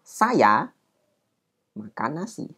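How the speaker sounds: "saya" held apart as the topic before "makan nasi"; slight pause after saya. Shown as a demonstration. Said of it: "ada sedikit jeda dulu".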